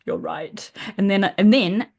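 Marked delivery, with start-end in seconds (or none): 0.0-0.7 breathy, dropped-down "Oh my God"; hushed realisation. Retold or imitated. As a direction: let the realisation sink in quietly. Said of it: "I was just like".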